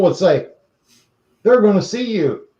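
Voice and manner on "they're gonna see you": higher-pitched warning voice; warning bystanders. Retold or imitated. People warning grandmother by the roadside, imitated high warning. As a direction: imitate people cautioning her.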